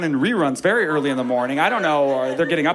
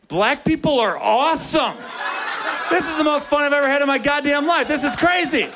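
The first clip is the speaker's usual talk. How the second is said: giddy joy; 11 semitones higher than usual. Retold or imitated. Kid after scoring, high giddy delight. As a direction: burst with joy, laughing. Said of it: "the only thought in my head was this".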